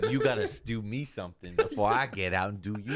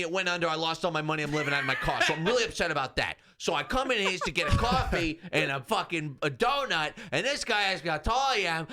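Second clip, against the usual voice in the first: whiny complainer; 8 semitones higher than usual. Retold or imitated. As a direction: complain like a wronged man. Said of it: "the way that he starts telling".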